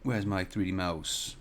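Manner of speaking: low-key, drawn-out question; slow, weary question. Real, not acted. Streamer still hunting through December posts, slow and weary. Still searching, wearier repeat.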